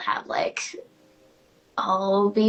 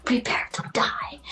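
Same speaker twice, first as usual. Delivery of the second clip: Inigo Montoya quote. Retold or imitated. Quoting a favorite movie, whispered dramatic vow. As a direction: hush the famous revenge line like a secret. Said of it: "the Inigo Montoya one".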